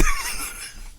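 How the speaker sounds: a squeal of laughter; high squeaky laugh. Real, not acted. Laughing remembering the kid's stare, squeaky giggle.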